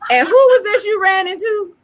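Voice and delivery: high, loud nosy questioning; mock interrogation. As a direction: grill her like a scolding parent. Mock-stern mother questioning.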